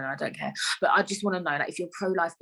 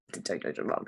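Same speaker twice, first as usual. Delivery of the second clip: low, breathy whispered take-back; whispered retraction; 11 semitones lower, 6 dB quieter than usual. Acted. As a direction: whisper it like a guilty correction. Cheeky jab, then takes it back.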